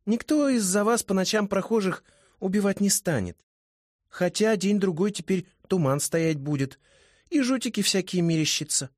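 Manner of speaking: put-on high, playful girl voice; a teasing young woman; high female voice. A narrator's reading. Kikimora voiced by narrator.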